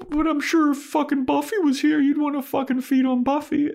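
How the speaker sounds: very high falsetto with mock jealousy; squeaky jealous girlfriend voice; jealous Willow.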